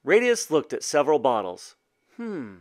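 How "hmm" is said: soft, pondering hum; murmur. A narrator's reading. Boy looking at several bottles, murmuring. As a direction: murmur it to yourself. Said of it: "he murmured".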